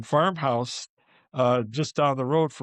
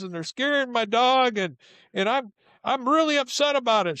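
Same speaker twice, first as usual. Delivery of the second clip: put-on high, shrill-pitched angry tirade from an old woman; angry old lady; 9 semitones higher than usual. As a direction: yell at trespassing kids, pitched high. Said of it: "she got really mad".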